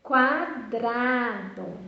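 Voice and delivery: quadrado drawn out slowly with a clear kwa; slow careful reading. Shown as a demonstration. Teacher showing quadrado slowly to the class. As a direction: stretch quadrado so the kwa is heard. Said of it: "Vocês perceberam quais são as sílabas".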